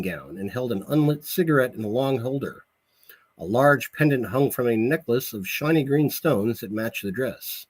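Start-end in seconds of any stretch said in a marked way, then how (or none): none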